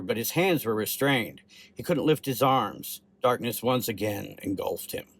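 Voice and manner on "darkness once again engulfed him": plain reading voice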